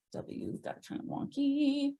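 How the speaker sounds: thinking aloud, barely projecting; mumbling to oneself. Real, not acted. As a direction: murmur it to yourself while working. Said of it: "I'm mumbling to myself".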